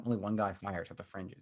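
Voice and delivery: normal talking voice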